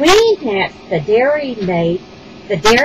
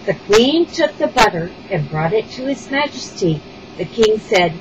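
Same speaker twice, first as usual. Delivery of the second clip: usual speaking voice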